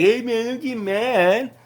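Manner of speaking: high, slow, smug taunting voice; a bratty mock voice pitched high and drawn out; mocking Cartman. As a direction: sneer it like a bratty kid teasing you. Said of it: "he acted a lot like Cartman".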